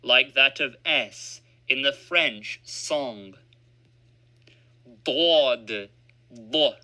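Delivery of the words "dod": "Dod" with a heavy emphatic d. Shown as a demonstration. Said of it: "Dod has an emphatic pronunciation".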